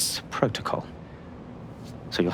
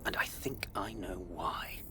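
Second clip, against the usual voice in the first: hushed, secretive whisper to an ally; 7 dB quieter than usual. Acted. Agent briefing an ally in secret, whispering. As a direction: whisper it so no one overhears.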